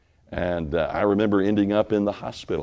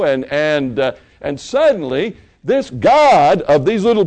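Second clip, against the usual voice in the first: rising, louder pulpit preaching; 9 semitones higher, 7 dB louder than usual. Acted. Pastor preaching to his church, loud and rising. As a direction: drive it home to the congregation.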